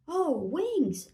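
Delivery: high, swooping sigh of awe; breathed wonder. A narrator's reading. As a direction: marvel at something longed for. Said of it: "breathed Tiny Jenny".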